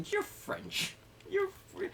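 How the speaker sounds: insisting, voice shooting up high; drunk insistence. Retold or imitated. Drunk woman insisting he is French. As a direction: push the point like a drunk.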